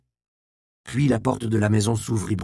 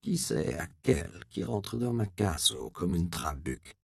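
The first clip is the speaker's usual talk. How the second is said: put-on old country woman; 3 semitones lower, 8 dB quieter than usual. A narrator's reading. Old woman confronts intruder.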